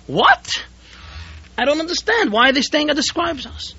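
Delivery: high, stunned question; shocked disbelief. Acted. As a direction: hit the "What?" high in disbelief. Host learns guests went elsewhere.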